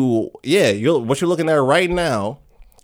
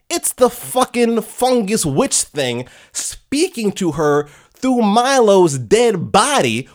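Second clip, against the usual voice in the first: ordinary delivery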